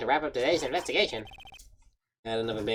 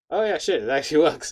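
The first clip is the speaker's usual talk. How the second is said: usual speaking voice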